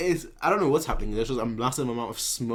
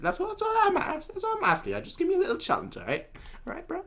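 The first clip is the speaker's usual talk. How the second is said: high mocking taunt; 6 dB quieter, faster than usual. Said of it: "He's like".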